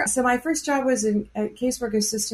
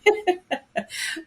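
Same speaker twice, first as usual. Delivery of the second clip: laughing; 8 semitones higher than usual. Real, not acted. Laugh before answering.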